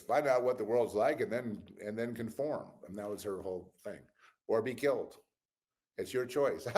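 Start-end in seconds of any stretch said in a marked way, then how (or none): 0.0-2.7 loud, high mock of a stern mother; mother's advice. Speaker imitating her mother's life lesson, loud and high. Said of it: "she goes". 4.5-6.7 loud, high mock ultimatum from mother; hammed-up stern mother giving an ultimatum; mother's threat. Speaker quoting mother's harsh choice, loud mock threat. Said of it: "she goes".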